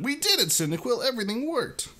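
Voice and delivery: high, bright victory cheer; high happy Mudkip. Acted. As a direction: celebrate like a little creature. Mudkip celebrating.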